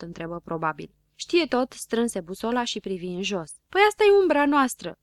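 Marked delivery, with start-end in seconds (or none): 3.7-5.0 high, loud amused exclamation. A narrator's reading. Știe Tot explains the mystery spot, amused. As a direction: reveal the simple answer with amusement. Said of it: "spuse el râzând".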